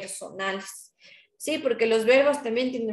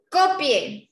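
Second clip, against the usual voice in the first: commanding order; 5 semitones higher, 4 dB louder than usual. Shown as a demonstration. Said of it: "Yo puedo decir".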